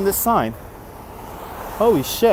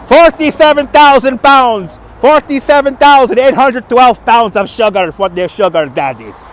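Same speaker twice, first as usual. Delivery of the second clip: sugar daddy voice; 3 semitones higher, 4 dB louder than usual. Pretend. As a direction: boom out the numbers proudly. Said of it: "You like their sugar daddy voice".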